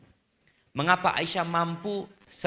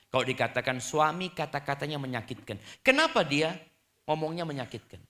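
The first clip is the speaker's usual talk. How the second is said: ordinary delivery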